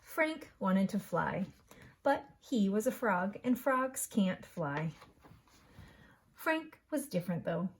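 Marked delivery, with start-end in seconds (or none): none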